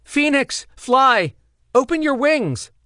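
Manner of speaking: very high, loud desperate yell. Acted. Evol yelling at falling Phoenix to fly. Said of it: "Evol yelled".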